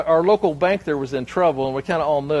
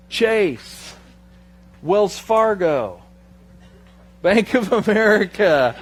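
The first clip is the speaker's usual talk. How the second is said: slow, louder joking list; mock list punchline; 4 semitones higher, 6 dB louder, much slower than usual. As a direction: drop each bank name slowly, for the laugh. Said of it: "capital One".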